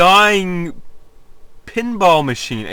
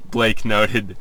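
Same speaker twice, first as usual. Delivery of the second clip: ordinary delivery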